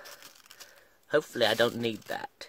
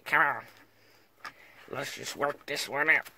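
usual speaking voice

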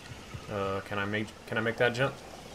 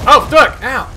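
startled curse; 11 semitones higher, 11 dB louder, slower than usual. Real, not acted. Grabbed wrong thing, got hurt.

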